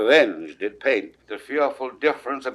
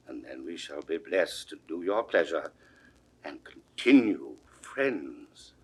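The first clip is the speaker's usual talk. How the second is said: much softer, humble begging; hushed pleading; 8 semitones lower, 9 dB quieter than usual. Acted. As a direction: plead softly, bowing to the priest. King begs the cardinal.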